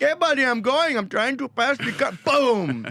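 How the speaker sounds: put-on high Indian accent; retold in Indian accent. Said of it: "I always do my Indian".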